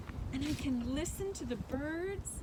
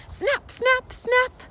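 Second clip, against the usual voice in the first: high, sharp snapping sounds; turtle snap; 14 semitones higher, 13 dB louder, slower than usual. Pretend. Guide snapping like a turtle for kids, high and sharp. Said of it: "What do you think the turtle did say?".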